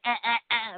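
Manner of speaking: short repeated "ah" bursts imitating bickering; bitchy mock yapping. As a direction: yap like someone bitching, not loud. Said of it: "all bitchy and shit".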